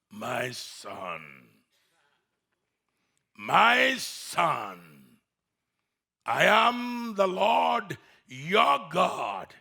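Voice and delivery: slow, deep booming voice of God. Acted. God calls down to the climber, deep and booming. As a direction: speak as the Lord, slow and grand. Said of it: "he heard this voice".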